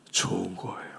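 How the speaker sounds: soft whispered reassurance. Real, not acted. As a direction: whisper it as comfort.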